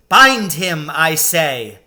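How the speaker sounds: loud, imperious order; commanding witch. A narrator's reading. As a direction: bark the order at the hags.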